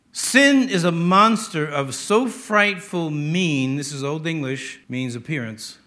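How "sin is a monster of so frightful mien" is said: slow solemn recitation; reading a poem. Shown as a demonstration. Preacher reading Pope to congregation, slow recitation. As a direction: read the line slowly. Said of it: "Listen to this".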